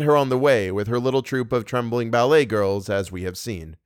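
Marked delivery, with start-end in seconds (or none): none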